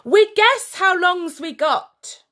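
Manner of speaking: high, loud beast voice; monster voice. A narrator's reading. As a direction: grumble like a worried brute. General talks to minions.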